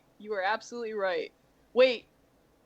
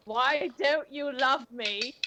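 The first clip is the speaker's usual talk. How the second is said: mock whine; 4 semitones higher, slower than usual. Whining at the DM.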